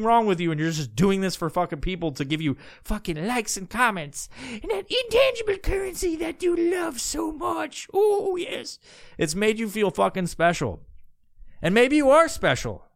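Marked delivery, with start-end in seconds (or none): 4.5-8.8 much higher, shouted mocking voice; sarcastic mock. Podcaster sneering at a faker's love of likes, high mock shout.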